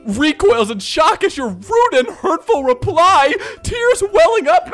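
high, loud mock-tearful wail; hammed-up wounded hurt. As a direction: read it as an overwrought victim. Acting out the hurt roleplayer's reply.

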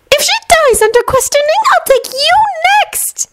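high menacing witch voice; threaten, dropping to nearly nothing; Bellatrix voice. A narrator's reading.

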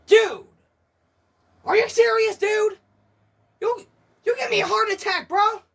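loud, high rattled outburst; shaken shouting. Real, not acted. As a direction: still rattled after a scare. Shaken after the scare.

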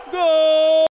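high loud GOL; goal shout. Real, not acted. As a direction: belt out the goal.

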